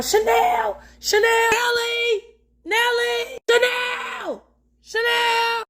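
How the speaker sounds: high, drawn-out calls across the street, mock-searching; calling a lost child's name outdoors; retold calling out. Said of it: "start calling Chanel name".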